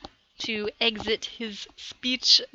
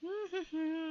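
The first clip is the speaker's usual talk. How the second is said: soft laugh; 5 semitones higher, 11 dB quieter than usual. Real, not acted. Laughs at result.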